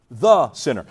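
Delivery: "the" hit hard and set apart; stress on THE. Shown as a demonstration. Preacher repeating the original wording, stressing "the". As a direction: repeat it with weight on "the".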